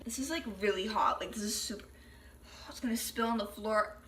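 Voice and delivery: hushed nervous whisper. Real, not acted. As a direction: whisper while carrying hot cup. Whispers over hot drink.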